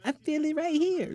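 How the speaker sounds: high breathy imitation of a woman; mock woman's voice. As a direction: voice her up high and soft. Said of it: "When they be grabbing their stomach".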